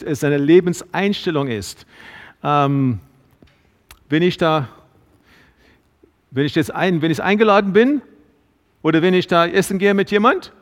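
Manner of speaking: normal talking voice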